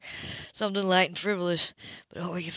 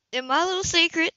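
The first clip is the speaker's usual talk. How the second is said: resolute female voice; 9 semitones higher, 6 dB louder than usual. A narrator's reading. She decides aloud.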